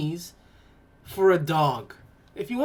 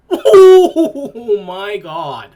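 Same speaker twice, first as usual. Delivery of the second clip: laughing; 12 dB louder, much slower than usual. Real, not acted. Laughing at the dog.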